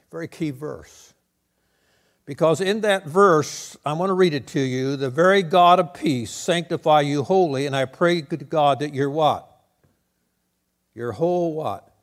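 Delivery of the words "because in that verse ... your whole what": loud scripture read with a call to the crowd; preaching. Acted. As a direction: read it out and demand the answer. Reading verse, quizzing congregation.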